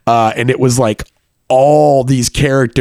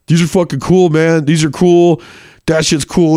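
put-on impressed frat boy; 6 semitones higher than usual. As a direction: play the clueless frat boy. Frat boy admiring the paintings.

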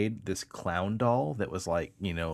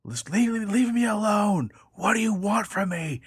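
imitating a terrified man on the phone; panicked plea; 12 semitones higher, 4 dB louder than usual. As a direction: beg the caller to stop, frantic. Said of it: "him saying".

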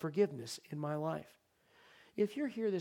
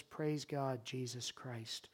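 quiet relief; 3 semitones lower, 6 dB quieter, much slower than usual. Acted. Paul's quiet answer of relief.

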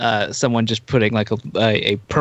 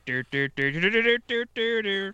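mock robot putter; 8 semitones higher, 4 dB quieter than usual. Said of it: "you just hear".

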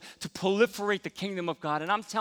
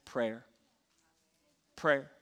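quiet one-word answer; 5 semitones lower, 6 dB quieter than usual. Retold or imitated. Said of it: "he said".

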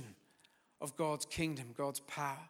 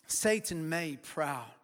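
normal talking voice

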